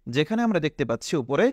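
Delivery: normal talking voice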